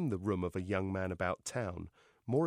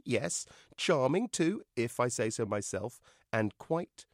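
ordinary delivery

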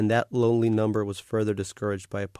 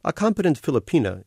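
plain reading voice

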